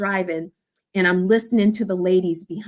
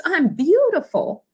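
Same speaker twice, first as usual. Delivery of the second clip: high, slow, beaming cry; an overjoyed woman; joyful exclaim; 10 semitones higher than usual. Retold or imitated. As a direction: glow with pride. Lady exclaims with joy.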